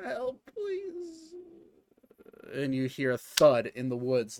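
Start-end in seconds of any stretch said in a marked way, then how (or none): 0.0-1.3 weak dying plea. Acted. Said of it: "You just hear".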